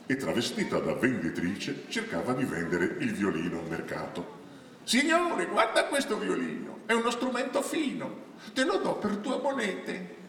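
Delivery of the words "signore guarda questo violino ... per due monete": high, sing-song market seller's pitch; brigand woman disguised as vendor, wheedling. Acted. Disguised brigand woman hawks a stolen violin to a passer-by, wheedling high voice. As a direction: coax the buyer in a high character voice.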